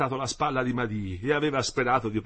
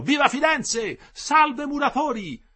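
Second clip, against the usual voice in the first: high, loud shout, last words drawn out slowly; shouted greeting; 8 dB louder, slower than usual. A narrator's reading. Someone on the train shouts greetings to the workers. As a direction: call out from a departing train window. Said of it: "gridò".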